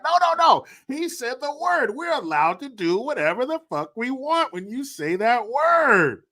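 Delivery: high-pitched, indignant protest; mock outrage. Mocking kids who claim the word allows anything, outraged.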